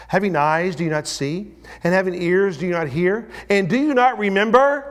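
climbing preacher's rebuke; rising rebuke. Acted. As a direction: press the questions harder. Jesus rebuking disciples, preached loud.

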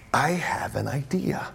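low, half-whispered brainwave; hushed realization. Acted. Steward hatching a plan to himself, hushed. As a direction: drop to a near whisper as the idea dawns. Steward's sudden idea, acted.

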